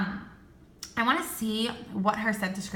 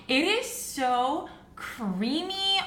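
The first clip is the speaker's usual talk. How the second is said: savoring; 6 semitones higher, much slower than usual. Real, not acted. Savoring the banana candle scent.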